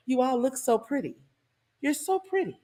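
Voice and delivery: syrupy fake praise, rising high at the end; mock sweet compliment dripping with sarcasm; sarcastic sweetness. Singer greets the concert crowd with sarcastic sweetness. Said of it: "didn't you feel the sarcasm?".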